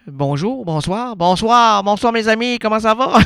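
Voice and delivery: high, loud showman hello; loud stage greeting. Acted. Host greeting the audience, high and loud. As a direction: belt the greeting to the crowd.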